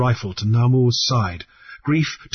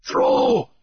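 sharp, much higher urging shout; urgent cry. A narrator's reading. The goat man urges Grief to throw, urgent. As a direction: push him to act now. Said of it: "the goat man urged".

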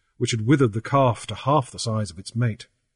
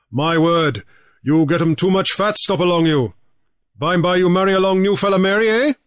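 raised, lively pidgin English; Grief's pidgin voice; 6 semitones higher, 5 dB louder than usual. A narrator's reading. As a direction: talk big to the old chief in pidgin. Grief teases Coho in pidgin.

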